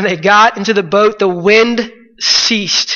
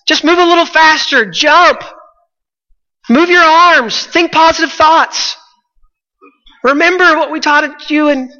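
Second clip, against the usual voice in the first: cheering Peter on; 7 semitones higher than usual. Pretend. Eager boat friend cheering Peter, high excited urging. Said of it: "just gonna encourage".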